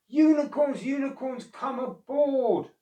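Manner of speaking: high, loud, stretched call across the water; elephants calling. A narrator's reading. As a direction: trumpet it out over the flood. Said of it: "The unicorns heard the elephants".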